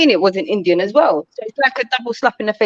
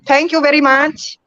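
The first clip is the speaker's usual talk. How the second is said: slow, cartoonish Indian accent; Simpsons-style Indian accent; slower than usual. Shown as a demonstration. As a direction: draw it out in a put-on Indian accent. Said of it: "sounds like The Simpsons".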